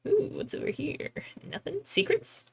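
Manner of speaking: soft, curious hush; playful whisper. Pretend. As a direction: whisper as if hunting treasure. Searching for secrets playfully.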